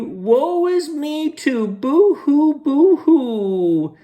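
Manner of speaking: very high, slow hammed-up boo-hoo; fake crying girl.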